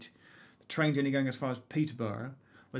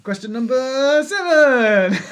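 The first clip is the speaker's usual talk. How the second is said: laughing; 9 semitones higher, 12 dB louder, much slower than usual. Real, not acted. Laughing after teary story.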